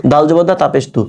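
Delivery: ordinary talk, nothing marked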